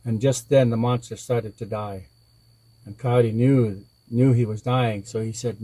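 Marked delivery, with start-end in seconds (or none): none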